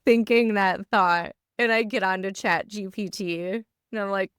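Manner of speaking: usual speaking voice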